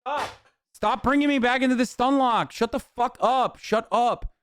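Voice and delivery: high-pitched exasperated yelling at chat; fed-up yelling. Real, not acted. Streamer telling chat to shut up, exasperated yelling. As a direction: throw it at them, totally done with it.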